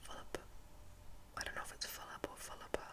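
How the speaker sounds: ordinary delivery, nothing marked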